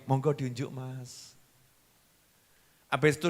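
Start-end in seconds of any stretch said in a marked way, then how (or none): 0.1-1.3 soft, humble offer to her husband; humble wife gently offering her husband coffee; gentle wife offering coffee. Acted. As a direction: hand him the coffee softly.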